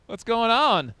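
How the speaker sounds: a brash, booming hello; cocky hello. Shown as a demonstration. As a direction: greet them like you own the room. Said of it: "[name] feeling a little cocky saying hello".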